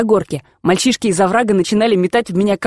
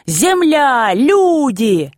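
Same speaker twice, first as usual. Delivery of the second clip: mild mock shout; 5 semitones higher, much slower than usual. A narrator's reading. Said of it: "хрипел и кричал в ухо мне".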